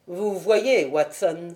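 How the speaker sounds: usual speaking voice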